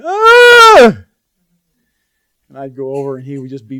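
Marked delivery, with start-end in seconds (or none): 0.0-1.1 put-on high, loud joyful shout of a boy; imitated excited cry. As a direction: shout out with sheer delight. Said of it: "he'd go".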